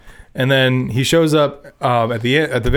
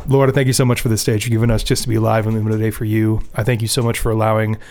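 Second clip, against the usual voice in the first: prayer; 3 semitones lower, 4 dB quieter, faster than usual. Acted. Closes podcast with prayer.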